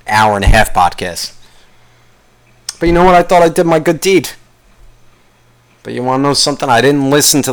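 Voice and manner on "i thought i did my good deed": usual speaking voice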